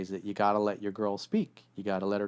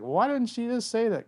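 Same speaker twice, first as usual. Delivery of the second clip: much higher, exasperated whine; baffled guy; 12 semitones higher than usual. Retold or imitated. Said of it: "the guy's like".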